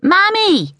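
very high yell for mother; child yelling. A narrator's reading. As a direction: yell for Mammy like an upset boy. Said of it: "Mark yelled".

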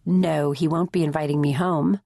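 plain reading voice

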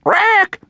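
shrill high squawk, loud and sudden; excited parrot screech. A narrator's reading. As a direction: let out an excited bird shriek. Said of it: "Doris screeched excitedly".

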